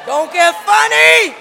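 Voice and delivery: shouted, punching funny; preacher's full-voice shout; loud call-out. Shown as a demonstration. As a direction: fire it at the congregation. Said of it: "Now tell your neighbor".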